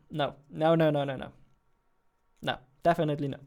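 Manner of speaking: ordinary talk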